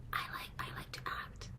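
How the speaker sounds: hushed, hesitant whisper; barely audible, breathy confession to a coworker. Retold or imitated. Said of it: "I remember whispering it to her".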